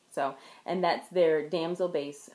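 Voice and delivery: usual speaking voice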